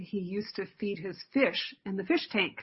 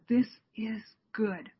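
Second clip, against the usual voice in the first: hushed whisper; 2 semitones higher than usual. Acted. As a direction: whisper God's words gently, in awe. God speaking at creation.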